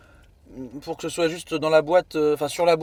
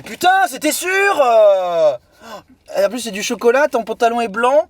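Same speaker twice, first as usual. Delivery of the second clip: higher, louder dismayed outburst; loud exclaiming; 6 semitones higher, 7 dB louder than usual. Real, not acted. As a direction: shout in dismay at the mess. Chocolate ice cream spilling.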